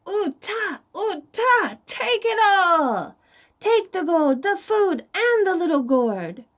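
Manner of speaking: angry shout. Acted. Furious king shouting at Rabbit to take everything. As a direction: hurl it all at him in a rage. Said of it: "The king angrily shouted".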